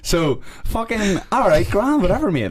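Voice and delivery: high sarcastic whatever; mock dismissal. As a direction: wave it off in a high mocking tone. Mocking dismissive reply.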